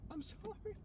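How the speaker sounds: very high squeaky mock whimper; high-pitched re-enacted pleading; imitated tearful apology. Retelling tearful apology during crying fit.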